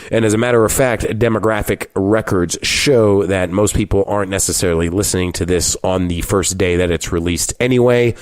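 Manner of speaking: usual speaking voice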